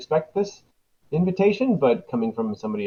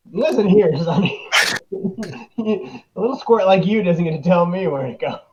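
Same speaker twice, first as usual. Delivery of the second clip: scolding; 7 semitones higher, 7 dB louder than usual. Acted. Ranger scolding the guard.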